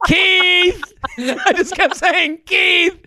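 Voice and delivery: high nervous laugh through it; embarrassed laughing. Retold or imitated. Comic caught by Keith's prank, laughing high and flustered. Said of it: "I was so embarrassed".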